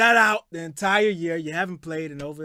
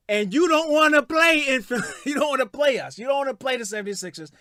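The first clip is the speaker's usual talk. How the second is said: mocking taunt; 5 semitones higher, 5 dB louder than usual. Mocking Ben in a rant.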